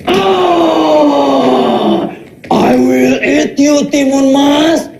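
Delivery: slow, drawn-out monster voice from a playful dad; mock giant. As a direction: growl it out like a pretend giant for the child. Father plays Buto Ijo giant.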